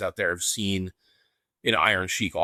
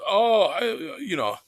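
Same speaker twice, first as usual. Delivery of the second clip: Iron Sheik voice; 10 semitones higher than usual. Retold or imitated. Fan imitating the Sheik at signing, Iranian accent. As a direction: put on the Sheik's heavy accent. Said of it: "in his Sheik voice".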